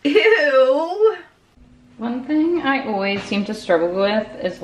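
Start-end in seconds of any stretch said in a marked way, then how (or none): 0.0-1.2 disgusted ew. Real, not acted. Reacting to her outfits.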